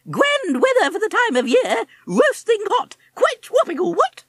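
very high, loud plummy toff cry; loud high upper-class exclamation; cheerful posh parrot. Acted. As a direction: chirp it like a jolly chinless wonder. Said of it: "Fweddy cried cheerfully".

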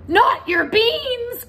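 loud, high, slow outraged cry. Acted. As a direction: wail it in horror. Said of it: "cried Scapito, outraged".

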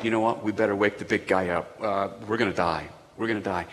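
a hint of frightened fishermen; lightly voiced panicking disciples; scared disciples. Disciples panic, wake Jesus.

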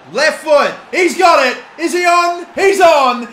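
high, loud rising excitement; real elated commentary shout; goal shout. Real, not acted. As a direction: yell him through on goal. Live goal build-up.

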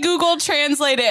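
ordinary talk, nothing marked

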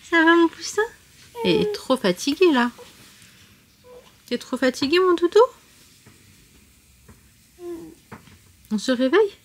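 sleepy husky good morning. Real, not acted. Waking her toddler from a nap.

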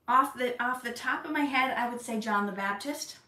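ordinary talk

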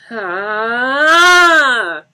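long, high, loud wail; drawn-out scream of despair; anguished scream. Acted. Johnny collapses on the couch, screaming in anguish. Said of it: "he cried out".

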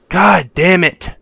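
slow, drawn-out furious yell; angry outburst. Real, not acted. Player yelling after losing all souls. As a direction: bellow each word in real rage. Dying again in a hard game.

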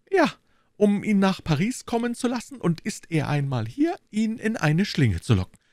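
high, fussy voice of the timid landlord; anxious little burgher. Acted. Bonacieux explaining to D'Artagnan, high and anxious. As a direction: play the nervous shopkeeper, pitched well up.

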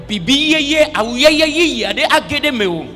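ordinary talk

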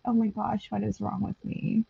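normal talking voice

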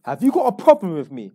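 loud retold challenge to the uncle's friend; retold confrontation. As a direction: front him up loud.

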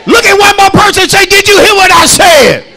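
loud, high, rhythmic sermon chant; shouted preaching. Acted. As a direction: drive every line home over the music. Preaching at full force.

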